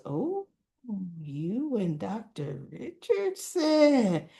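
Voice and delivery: slow, drawn-out singsong tease; teasing. Retold or imitated. Ashley to coworker about the doctor, teasing. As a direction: stretch it out like schoolyard teasing.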